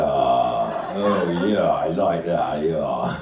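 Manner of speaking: very deep, slow puppet drawl; ponderous deep male character; man's dummy voice. Acted. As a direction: sink low as the husband dummy. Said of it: "What about a voice like this?".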